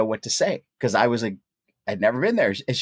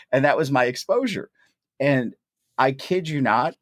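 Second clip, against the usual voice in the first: normal talking voice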